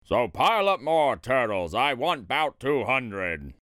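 low, slowing royal order; imperious turtle king issuing orders; thundering command. A narrator's reading. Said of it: "his royal voice thundered".

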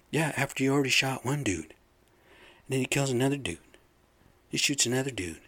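ordinary talk, nothing marked

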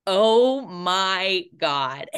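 slow, drawn-out disbelief; stretched, loud gasp of shock; stunned oh my God. Retold or imitated. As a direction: let it sink in slowly. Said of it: "And I went".

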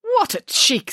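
high indignant exclamation; offended outburst, pitched well up. A narrator's reading. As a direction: protest as if affronted. Nanny outraged at the salon.

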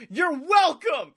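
imitated loud, high, drawn-out shout; retold yell. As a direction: call it out high and slow, mimicking a yell. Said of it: "he just yells".

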